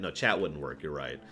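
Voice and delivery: ordinary talk, nothing marked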